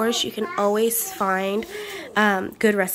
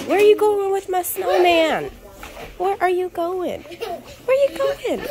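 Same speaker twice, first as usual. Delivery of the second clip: high, loud teasing voice, laughing; high playful voice; 8 semitones higher, 4 dB louder than usual. Real, not acted. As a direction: tease the pet in a sing-song. Playfully scolding dog with snowman.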